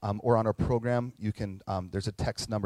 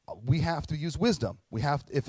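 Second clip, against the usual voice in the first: ordinary delivery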